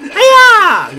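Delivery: high loud karate cry; mock kung fu battle cry; karate yell. Real, not acted. Kicking a barrel over, high loud karate cry. Attacking with a kick.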